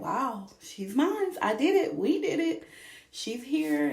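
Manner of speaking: high, slow and amazed; awed joy. Retold or imitated. New mom first seeing her baby, slow, high and awed. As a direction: let it rise slowly in wonder. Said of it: "I was just like".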